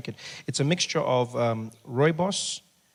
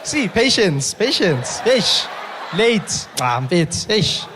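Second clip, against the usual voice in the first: high, loud exasperated calls; exasperated shout; 8 semitones higher, 7 dB louder, much slower than usual. Real, not acted. Comedian scolds latecomers in the audience, loud and high. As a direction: scold the late arrivals.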